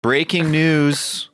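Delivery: slow, mock breaking news; news anchor. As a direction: announce it like a newscaster.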